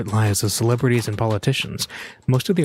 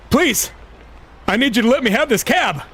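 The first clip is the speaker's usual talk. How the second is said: much higher, louder begging; desperate pleading; 12 semitones higher, 4 dB louder than usual. A narrator's reading. Stranger begging the passenger for his taxi, desperate. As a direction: plead like your life depends on it.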